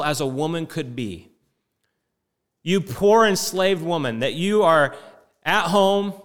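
a loud, stretched-out "You poor thing" full of contempt; mock pitying scorn. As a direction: sneer down at her like a pitying nurse. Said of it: "an expression of, like, despicable".

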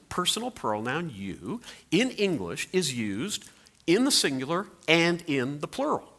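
ordinary talk, nothing marked

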